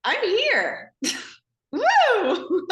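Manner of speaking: high, loud triumphant whoop; laughing, proud self-celebration; joyful declaration. Retold or imitated. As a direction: announce yourself with a laughing cheer. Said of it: "but it's like".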